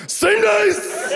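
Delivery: high mock yell across the craps table. Gambler yelling the call at the table, high mock yell. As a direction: bellow it out mid-roll. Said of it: "I yelled".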